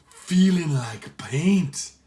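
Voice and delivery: puzzled Irish pirate; Irish accent. Acted. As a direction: feel the shirt and muse in Irish. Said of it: "Sean's accent was so heavy".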